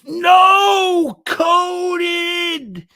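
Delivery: very high, loud drawn-out yell; full-voiced shout. Streamer reading a viewer's message, high shout. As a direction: belt it out high and long.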